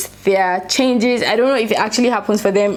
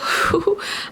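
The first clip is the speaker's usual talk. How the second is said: light laugh; 11 semitones higher than usual. Real, not acted. Excited about last day.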